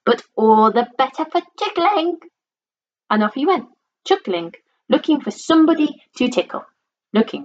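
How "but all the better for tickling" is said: higher, playful cheery voice; cheerful. A narrator's reading. Mister Tickle relishing his long arms, cheerful and higher. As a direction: lift the pitch playfully as it goes. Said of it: "said Mister Tickle cheerfully".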